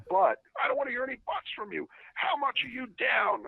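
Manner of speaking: high, cutting-off imitation of an angry boss; mock boss demanding an answer; yelling boss. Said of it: "Why are you yelling at me".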